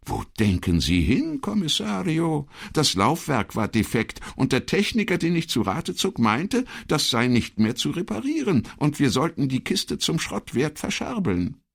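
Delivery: high-pitched, emphatic secretary voice; insistent secretary. Acted. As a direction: set the record straight in a high voice. Voices Elettra in dialogue.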